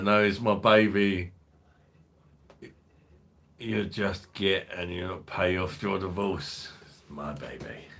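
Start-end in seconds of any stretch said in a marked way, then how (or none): 0.0-1.3 proud loud declaration. Acted. Repeats phrase, first loudly. 6.8-7.9 softer and lower, under the breath; soft lower repeat. Acted. Repeats phrase, then softly.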